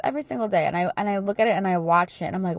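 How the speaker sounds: normal talking voice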